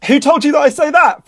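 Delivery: high, laughing disbelief; laughing surprise. Real, not acted. Author laughing at being teased by host.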